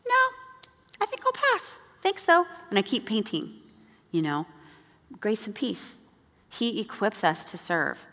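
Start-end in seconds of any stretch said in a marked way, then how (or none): 0.1-2.5 very high, airy polite refusal; breezy brush-off. Retold or imitated. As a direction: decline sweetly, very high.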